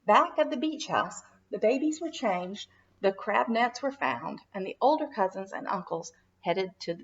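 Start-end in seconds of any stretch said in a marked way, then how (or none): none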